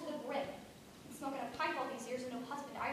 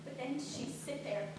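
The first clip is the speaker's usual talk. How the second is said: usual speaking voice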